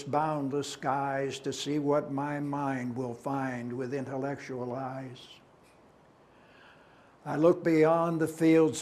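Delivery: declaimed verse, each stanza launched loudly; poem recitation. Acted. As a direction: declaim it, stretching the line endings. Recites own poem aloud.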